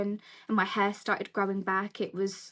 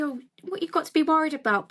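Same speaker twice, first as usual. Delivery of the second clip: dismissive people; 6 semitones higher, faster than usual. Retold or imitated. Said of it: "People say".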